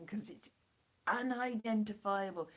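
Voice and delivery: ordinary delivery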